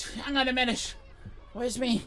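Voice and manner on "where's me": usual speaking voice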